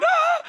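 short, loud, high-pitched mock scream; freaked-out yell. Preacher mimics believers fleeing from God, freaked-out mock scream. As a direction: yelp in mock terror. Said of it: "we're freaking out all the way going".

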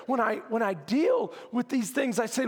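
ordinary talk, nothing marked

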